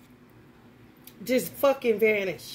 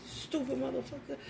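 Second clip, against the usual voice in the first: muttered insult, much softer; cussing at the beetle; 2 semitones higher, 10 dB quieter than usual. Retold or imitated. Cursing the beetle on the floor, soft and contemptuous. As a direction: spit it under your breath. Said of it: "cussing at the beetle like it was him".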